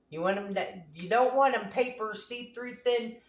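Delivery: usual speaking voice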